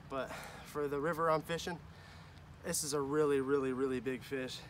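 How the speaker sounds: distant, quiet recap; far from mic. Real, not acted. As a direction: speak from across the bank.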